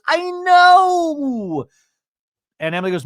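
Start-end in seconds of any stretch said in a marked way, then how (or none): 0.1-1.6 very high, loud, drawn-out squeal; hammed-up high "know", held long; mock wailed agreement. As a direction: imitate an over-the-top wail. Mimicking an exaggerated agreement.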